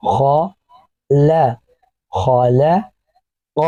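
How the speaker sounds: each sound stretched very slowly and joined. Shown as a demonstration. Teacher sounding out a word for pupils, very slow and stretched. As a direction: draw the syllables out slowly, joining sound to sound. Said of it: "پہلے ان دو آوازوں کو جوڑیں گے".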